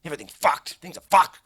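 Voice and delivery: ordinary talk, nothing marked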